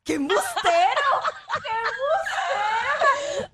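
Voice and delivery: high-pitched cry through helpless laughter; real laughing outburst. Real, not acted. Co-host calling out his claim of respect, laughing hard. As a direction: shriek it out while cracking up.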